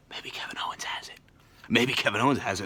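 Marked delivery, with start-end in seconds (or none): 0.1-1.2 quick conspiratorial whisper. Real, not acted. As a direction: let us in on a secret. Conspiratorial guess.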